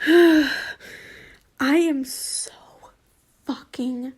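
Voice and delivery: angry whisper through the teeth; whispered anger. Real, not acted. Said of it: "fucking angry".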